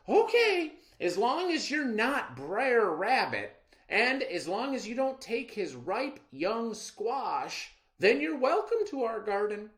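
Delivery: trusting and eager to please; little girl welcoming the stranger; naive little girl. Acted. Girl answers rabbit.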